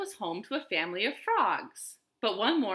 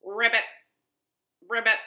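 short, louder ribbits with a gap between; practicing frog croaks; 2 semitones higher, 5 dB louder, faster than usual. A narrator's reading. Little pig practicing alone, short frog croaks. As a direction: croak each one like a frog. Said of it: "practicing his".

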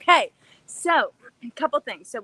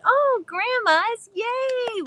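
delighted; 12 semitones higher, 4 dB louder, much slower than usual. Real, not acted. Greeting viewers joyfully.

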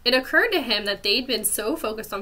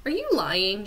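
ordinary delivery

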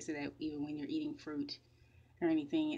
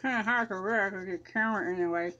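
talking while brushing teeth; words around a brush; toothbrush in mouth; 4 semitones higher, 6 dB louder than usual. Real, not acted.

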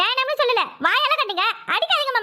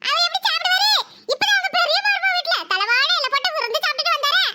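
higher, mocking kid's voice; cheeky boy voice; 5 semitones higher than usual. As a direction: sass the grandfather like a bratty boy. Boy taunts grandfather.

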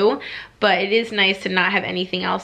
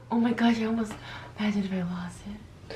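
whispering; 8 dB quieter than usual. Real, not acted. Nearly dropped the pill, gasps.

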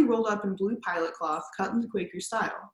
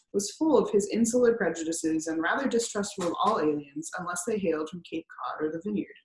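plain reading voice

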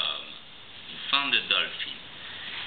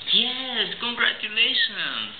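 high, bright cheer; excited praise; 11 semitones higher, slower than usual. Real, not acted. Praising toddler.

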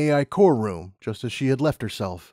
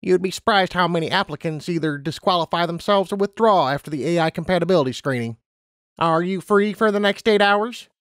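lightly voiced interviewer, higher pitch; Harmony Treasure, relenting; 6 semitones higher than usual. A narrator's reading. Interviewer conceding a chance to Lumina, lightly voiced character. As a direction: give in, somewhat raised voice.

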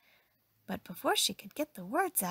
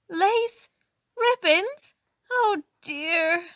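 high, loud, staccato horror; shocked outcry; 11 semitones higher, 9 dB louder, much slower than usual. A narrator's reading. Employer opens icebox on lace-trimmed meat, high loud shock. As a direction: exclaim each find, aghast.

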